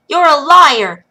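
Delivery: high, loud furious outburst; angry accusation. Acted. Lil angrily shouts at the wild woman who wants Henry removed. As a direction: throw the word liar at her.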